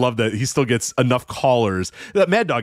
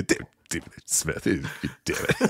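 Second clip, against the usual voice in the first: whispered, much softer inner grumble; muttered whisper; 9 semitones lower, 9 dB quieter than usual. Retold or imitated. Rome privately fuming after the call, whispered grumble. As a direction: hiss it under your breath.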